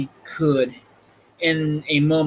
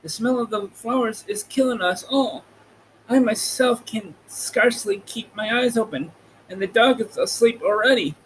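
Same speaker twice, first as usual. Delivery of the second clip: higher voice for the Lion, slightly quicker at the end; 7 semitones higher than usual. A narrator's reading. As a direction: voice the weary Lion a touch higher. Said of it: "said the Lion".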